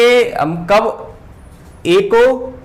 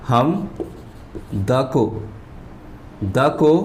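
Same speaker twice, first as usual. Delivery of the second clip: usual speaking voice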